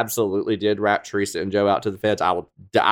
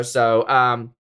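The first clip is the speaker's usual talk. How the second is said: normal talking voice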